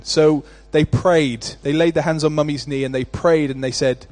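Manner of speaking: normal talking voice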